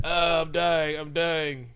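hammed-up death throes; fake dying. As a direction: moan it out, badly faked. Thanos fakes dying.

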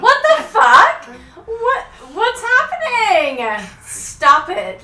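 high, loud laughing outrage; outraged disbelief. Real, not acted. Reacting to boyfriend's jealous line.

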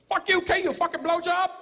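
put-on high, fast Vietnamese-accented cussing. Vietnamese guy cussing out the comedian, high accented rant. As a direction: spit it out like an angry shop guy. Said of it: "He goes".

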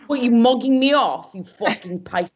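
indignant Essex put-on, breaking into a laugh; mock Essex accent. Imitating reality show cast in a row, mock Essex accent. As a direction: act a row in broad Essex. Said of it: "people who talk like this".

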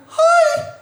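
shrill falsetto hi; contestant's falsetto attempt at the top note; very high squeal. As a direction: squeak the word right up at the top. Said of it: "we're looking for high jump".

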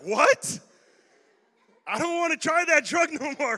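loud, high, laughing outcry; shocked viewer. Retold or imitated. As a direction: recoil in mock horror. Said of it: "And you're like".

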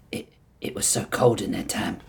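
breathy whispered confession. Acted. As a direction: whisper it, shaken and cold. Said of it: "he whispered".

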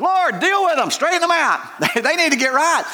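high, put-on pleading voice; high mocking whine; mock self-righteous prayer. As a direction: whine at God about other people. Mock prayer about others.

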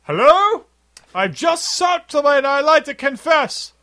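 high loud announcing voice; loud high movie quote. Retold or imitated. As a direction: call out high and loud to confess. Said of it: "the movie character".

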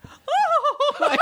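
very high, delighted squeal through laughter; imitated excited reaction. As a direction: mimic a thrilled mom, voice shooting way up. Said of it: "She was so excited".

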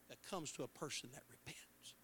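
hushed, breathy whisper; secret-like whispered aside. Real, not acted. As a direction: drop it to a whisper for effect. Preacher drops to a whisper.

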